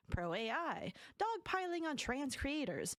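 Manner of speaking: ordinary delivery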